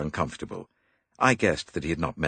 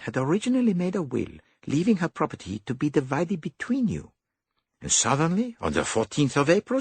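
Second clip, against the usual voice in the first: higher, fussy little detective; higher dapper detective; 13 semitones higher than usual. A narrator's reading. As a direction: play the clever Belgian. Poirot voiced higher.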